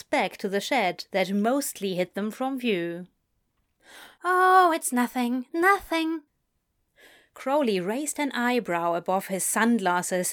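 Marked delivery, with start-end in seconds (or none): 4.2-6.2 flustered deflecting. Acted. Nervous boy brushes off worry.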